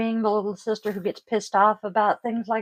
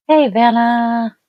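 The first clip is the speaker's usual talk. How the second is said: louder, drawn-out slow hey; cheerful greeting; 3 semitones higher, 9 dB louder, slower than usual. Real, not acted. Streamer spotting a regular viewer, bright slow hey. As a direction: call out warmly to a friend.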